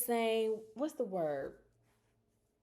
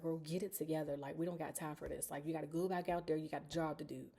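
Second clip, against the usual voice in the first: hushed firm inner pep talk; soft, low, telling yourself off; quietly coaching herself after crying; quiet self-talk; 4 semitones lower, 8 dB quieter, faster than usual. Retold or imitated. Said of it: "getting myself together".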